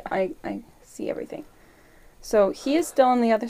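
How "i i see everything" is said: whisper. Real, not acted. Quick whispered realisation.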